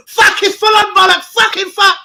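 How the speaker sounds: rising to a high loud yell; building pained yell. Retold or imitated. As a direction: let the pain build into a shout. Acting delayed groin pain hitting.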